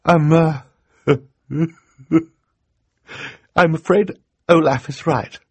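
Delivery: high, halting, tearful voice; acted tearful admission; through tears. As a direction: break off and struggle on through tears. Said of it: "said through her tears".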